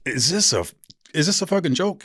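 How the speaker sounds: high, incredulous question to the coach; disbelief. Retold or imitated. Asking his coach about the arrest, high and incredulous. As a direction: ask it like this can't be real. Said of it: "and I go".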